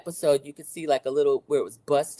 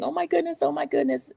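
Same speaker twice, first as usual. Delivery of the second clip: high, distressed repetition; injured woman repeating her shock, much higher; 10 semitones higher than usual. Retold or imitated. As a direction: say it in stunned dismay. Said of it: "Angie was saying".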